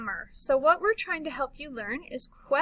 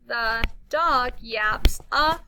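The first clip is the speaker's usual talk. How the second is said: slow, louder, nearly shouted; excited cry; 3 semitones higher, 6 dB louder, much slower than usual. Shown as a demonstration. Teacher reading an exclamation to the class, excited. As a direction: cry it out with delight. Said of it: "We're excited".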